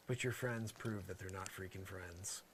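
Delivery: ordinary talk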